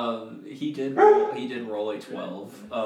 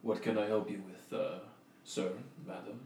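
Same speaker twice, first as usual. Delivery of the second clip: silky-voiced orc shopkeeper; a smooth, gentle voice from a big brute. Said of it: "like, smooth voice".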